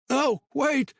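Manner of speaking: high, frantic exclamation; panicked cry. A narrator's reading. Patty panics.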